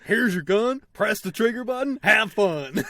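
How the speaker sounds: loud, high, punchy sales cheer; gleeful mock pitch. Game handing a player a gun, gleeful shout. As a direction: shout it like an easy invitation.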